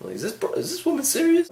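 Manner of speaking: high, incredulous aside; mock disbelief. To the guy behind him about the woman, mock disbelief. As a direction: pitch it up in baffled disbelief.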